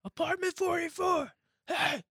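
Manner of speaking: mock street yell, very high. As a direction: imitate a man hollering up from the street. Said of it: "just starts yelling".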